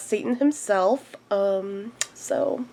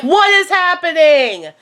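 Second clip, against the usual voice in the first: high, drawn-out comic wail; mock freak-out; 8 semitones higher than usual. Guest reacting to host's revelation, hammed-up high wail. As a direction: cry out in exaggerated disbelief, slowly. Acted shocked reaction to host.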